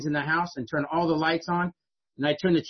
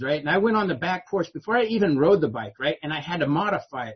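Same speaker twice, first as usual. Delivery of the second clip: usual speaking voice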